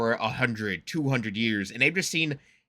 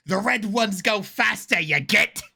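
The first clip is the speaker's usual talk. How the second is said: a hammed-up, higher and slow Cockney orc voice; a poor Cockney football hooligan orc; bad Cockney orc accent; 8 semitones higher, 3 dB louder, slower than usual. A fan imitating an orc in Cockney, mock boast. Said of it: "I can't do the accent well enough".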